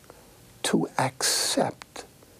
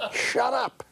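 a much higher mock-shrewish wife telling her husband to shut up; old wife's retort; 11 semitones higher than usual. Old wife cuts off her husband, much higher mock retort. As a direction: play the bickering old wife, pitched way up.

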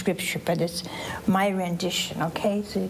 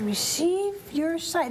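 slow and higher-pitched; solemn pronouncement; 7 semitones higher, slower than usual. Retold or imitated. Preacher repeats Jesus' words to the congregation, solemn pronouncement. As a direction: stretch each word as a proclamation.

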